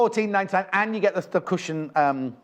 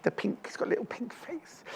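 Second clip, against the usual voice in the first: whisper; 6 semitones higher, 10 dB quieter than usual. Real, not acted. Cooing over cute toy's face.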